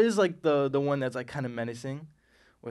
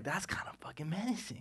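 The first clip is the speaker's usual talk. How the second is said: whispered, wary reaction; hushed menace; 3 semitones higher, 12 dB quieter than usual. Retold or imitated.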